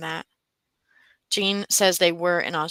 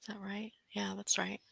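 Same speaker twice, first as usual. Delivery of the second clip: whisper; 13 dB quieter than usual. Real, not acted. Whispered aside.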